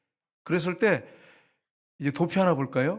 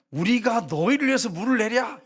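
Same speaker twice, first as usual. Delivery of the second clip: raised, louder retort to the people; mock indignant Moses; 7 semitones higher, 4 dB louder than usual. Moses retorting to grumbling Israelites, indignant. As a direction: fling it at them like Moses. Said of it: "근데 뭐라고 하냐면".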